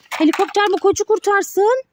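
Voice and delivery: ordinary talk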